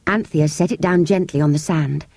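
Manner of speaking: plain reading voice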